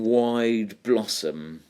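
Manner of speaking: "wide blossom" slow, both beats heavy; spondee on wide blossom. Shown as a demonstration. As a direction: drag out "wide blossom" evenly. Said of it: "another spondy in".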